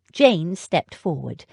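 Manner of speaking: ordinary delivery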